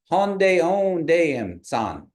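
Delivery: Honde onde held high and slow, em san coming down; phrase tones. Shown as a demonstration. Teacher models the tones to follow, high Honde onde falling to em san. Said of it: "They're going up all the way".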